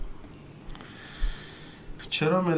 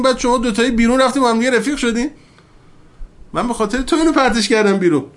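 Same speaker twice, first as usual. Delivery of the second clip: rising, louder voice voicing God's plea; impassioned preaching; 7 semitones higher, 5 dB louder than usual. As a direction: preach it with building fervour. Preaching at full force. (موعظهٔ پرشور و بلند)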